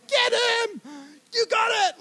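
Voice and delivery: high, drawn-out mock yell; imitated parent yelling. Parent yelling at a kid to tackle, imitated high yell. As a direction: call out high, without full volume. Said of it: "there's this parent yelling".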